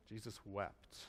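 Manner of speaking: hushed. Acted. Sermon closing on Jesus' tears.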